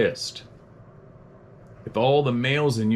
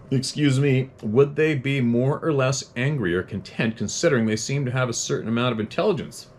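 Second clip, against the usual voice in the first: higher, even letter-reading voice; 4 semitones higher than usual. Acted. Host reads a listener's question to viewers, measured reading. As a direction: read the listener's note out carefully.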